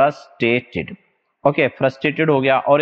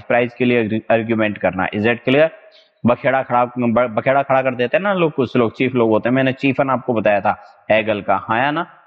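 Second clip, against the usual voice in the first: normal talking voice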